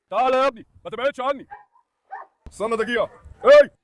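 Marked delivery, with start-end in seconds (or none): 3.4-3.8 sudden loud question at the dogs; startled shout; loud startled what. Real, not acted.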